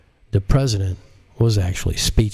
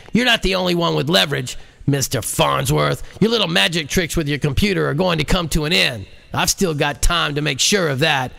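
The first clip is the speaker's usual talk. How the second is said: higher, heated near-yell; angry president; 7 semitones higher than usual. A narrator's reading. The president threatens JL over the nuclear silos, near-yelling. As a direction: snarl at him like a cornered president. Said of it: "The president was almost yelling".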